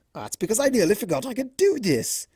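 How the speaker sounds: a hint of a vain showman, playful; lightly voiced boastful Discord. A narrator's reading. Discord showing off his tail to Fluttershy, lightly voiced and smug. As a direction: preen and brag with a grin in the voice.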